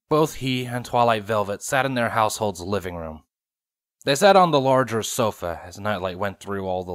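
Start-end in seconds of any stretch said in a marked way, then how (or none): none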